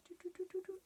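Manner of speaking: high, light little doot doot noises; playful doot sounds. Shown as a demonstration. Teacher showing kids the caterpillar sign, high playful doot doot. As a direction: make the caterpillar hop up your arm. Finger caterpillar climbs arm.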